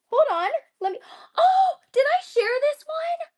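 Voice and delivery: high, excited squeals of surprise; thrilled, voice jumping up. Real, not acted. Excited reaction to fast sellout.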